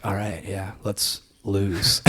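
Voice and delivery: hushed, low aside, as if plotting; low-key scheming. Retold or imitated. Player retelling his sly plan to throw the game, hushed scheming. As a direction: murmur it like a sly suggestion. Retold plot to lose bet.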